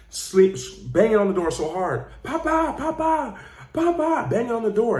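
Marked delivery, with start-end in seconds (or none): 2.2-4.3 put-on high pleading little-girl cries; crying little girl. Daughter crying at the door for her father, high pleading. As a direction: call out for papa like a tearful small child. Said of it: "Little girl's crying".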